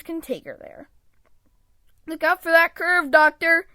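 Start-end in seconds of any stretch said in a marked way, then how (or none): 2.1-3.7 louder, higher urgent call; alarmed cry of look out; warning shout. Acted. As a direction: shout a warning before the crash. Warning the driver at curve.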